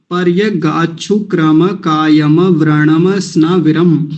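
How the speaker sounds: पर्य गाछु क्रम कायम व्रणम स्नाविरम, slow and split; careful recitation. Shown as a demonstration. Teacher repeating the mantra for practice, slowly.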